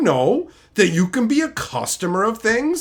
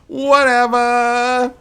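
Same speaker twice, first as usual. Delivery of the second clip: drawn-out whatever; 4 semitones higher, 5 dB louder, much slower than usual. Pretend.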